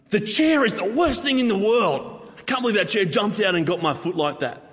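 high, hammed-up indignant rant at a chair; mock outrage. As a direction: play up the petty fury, pitched high. Mock rant at stubbed toe.

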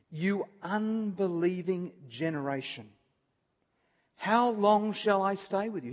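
Jesus in mounting frustration at the disciples; frustrated. Acted. Jesus rebuking the unbelieving generation, frustrated. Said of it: "He's frustrated".

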